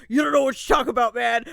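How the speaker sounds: loud, fast macho retort; masculine voice. Retold or imitated. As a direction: bark it like a tough guy sure he's right. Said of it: "voice came out of him".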